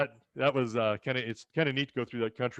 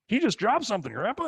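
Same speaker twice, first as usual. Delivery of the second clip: much higher, louder shout to grandpa; excited call; 11 semitones higher, 5 dB louder than usual. Retold or imitated. A boy shouting to grandpa about the plane's drop. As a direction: call out thrilled at the airplane.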